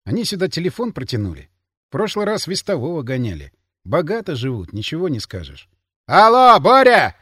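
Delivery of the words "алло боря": much louder, higher hail; calling into phone. A narrator's reading. Guard phones Боря from the guardroom, calling loudly. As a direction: shout down a poor phone line.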